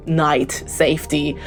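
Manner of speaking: ordinary delivery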